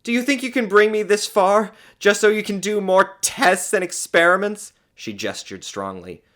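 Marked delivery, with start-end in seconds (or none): 0.0-4.7 high, loud challenge; indignant. A narrator's reading. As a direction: accuse him in outrage. Scornful woman challenging the doctor.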